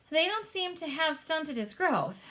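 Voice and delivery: a lightly voiced amused queen; Queen's voice. A narrator's reading. The Queen remarking on the giant, dry. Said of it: "the Queen said".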